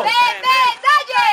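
high, loud urging; shouting encouragement. Real, not acted. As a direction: drive him on at full voice. Bench urging a player. (berro de ánimo)

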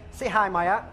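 ordinary delivery

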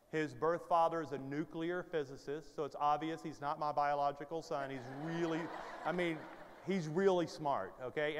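ordinary delivery

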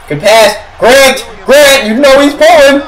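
hyped fan urging on the shot; building excitement. Real, not acted. Calling the play as it builds.

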